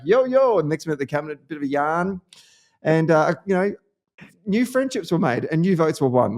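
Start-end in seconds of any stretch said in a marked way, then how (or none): 0.0-0.6 high, loud answering call; calling back. Retold or imitated. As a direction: holler back from inside the house. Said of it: "someone yelled back".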